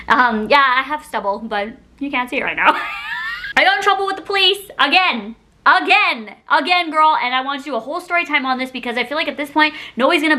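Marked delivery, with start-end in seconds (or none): 2.8-3.5 squeaky laugh. Real, not acted. High breathy laugh. 3.6-7.2 exasperated. Real, not acted. Exasperated disbelief.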